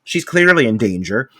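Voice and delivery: ordinary talk, nothing marked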